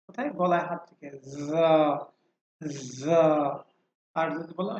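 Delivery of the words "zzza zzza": যা opening on a sustained z buzz; voiced sound. Shown as a demonstration. Teacher has students feel the throat, buzzing voiced যা. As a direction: hold the humming z, then open into যা. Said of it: "প্রথমটা কিন্তু voiced".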